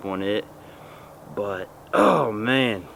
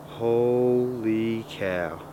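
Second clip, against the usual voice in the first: usual speaking voice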